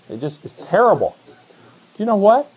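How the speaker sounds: ordinary talk, nothing marked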